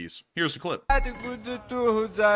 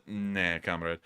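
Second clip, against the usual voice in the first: imitating a cold Russian comrade, lower and softer; a dry low put-down; mock gun's reply; 7 semitones lower, 6 dB quieter, much slower than usual. The narrator voicing the howitzer answering the soldier, low and soft. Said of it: "And then the Panzerhaubitze said".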